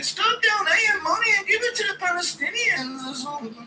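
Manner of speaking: very high falsetto plea; Ian impression. Mocking Ian, whiny high plea to donors. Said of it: "Impression of Ian saying".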